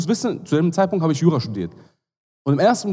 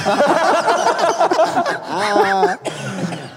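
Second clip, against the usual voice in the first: high laugh; 12 semitones higher than usual. Real, not acted. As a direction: burst of high-pitched laughter. Guest laughing at the banter.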